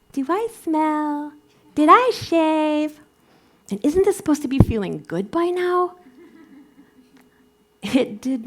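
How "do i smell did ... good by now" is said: high, fretful inner voice; anxious racing thoughts. Acted. Storyteller acting out her wandering mind during the practice, high fretful questions. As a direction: worry aloud, pitched well up and getting louder. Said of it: "My mind was wandering all over the place".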